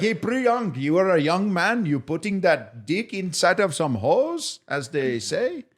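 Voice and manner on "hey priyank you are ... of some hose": high-pitched mock Indian accent, slowing to the end; Indian accent impression. Friend mocking a coworker: hammed-up Indian accent. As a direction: tease him in a put-on Indian accent. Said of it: "I'm gonna try to do the accent the best I can".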